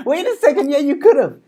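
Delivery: loud, high, laughing outburst; mock accusation. Real, not acted. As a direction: burst out in playful alarm.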